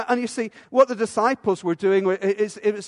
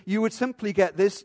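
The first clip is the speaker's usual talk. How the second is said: ordinary delivery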